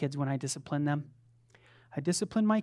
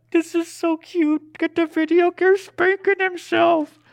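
high, slow delighted coo; gushing parents; 12 semitones higher, 7 dB louder than usual. Retold or imitated. Parents cooing as their toddler spanks himself, high and delighted. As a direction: coo over something adorable. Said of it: "Grace and I are like".

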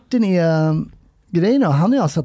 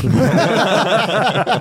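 laughing; 4 semitones higher than usual. Real, not acted. Comedian amused after calling himself a good person, laughing. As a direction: burst of laughter, higher pitched.

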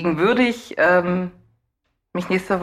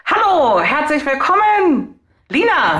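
high, drawn-out showy welcome; sing-song greeting; 9 semitones higher, 5 dB louder, slower than usual. Pretend. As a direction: greet her like a game show host. Playful exaggerated greeting to co-host.

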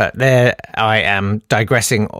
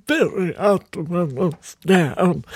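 put-on slow, higher, softer old lady; Ruby's voice; 4 semitones higher, 5 dB quieter, slower than usual. Shown as a demonstration. Showing how great-aunt sounded, slow and soft. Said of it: "That was her voice".

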